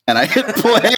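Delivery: high laughing through the words; cracking up at his own prank. Real, not acted. A boyfriend laughing as he tells Joe he hit play.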